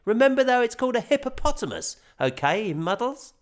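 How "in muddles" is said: ordinary delivery, nothing marked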